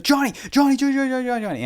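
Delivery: high, loud panicked yelling for help; screaming in a panic for his brother. Retold or imitated. Panicking teen yelling for his brother, high and loud. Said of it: "I was like".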